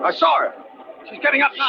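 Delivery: high, urgent alarmed cry; husband sees the figure rising on the dark road, alarmed. Acted. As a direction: blurt it out in sudden alarm.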